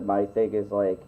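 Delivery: ordinary talk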